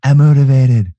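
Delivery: loud gung-ho soldier. Pretend. Gung-ho pep self-talk.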